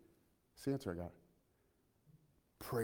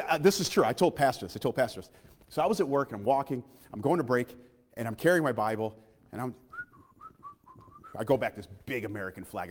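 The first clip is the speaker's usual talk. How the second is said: usual speaking voice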